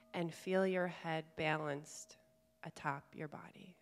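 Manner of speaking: calm meditation guide, long pauses between phrases; guided meditation. Real, not acted. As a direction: gently guide them inward, leaving space to breathe. Leads a guided chakra meditation.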